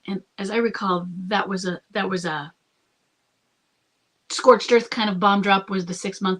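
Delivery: normal talking voice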